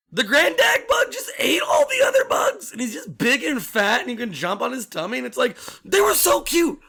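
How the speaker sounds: high, loud, outraged laughing shout; indignant shout. Real, not acted. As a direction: yell it in comic outrage. Outraged laughing rant about the grubs.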